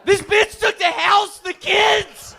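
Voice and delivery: high furious shout; shrieking rant. Acted. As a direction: scream it like the divorce still burns. Shouted joke about ex-wife.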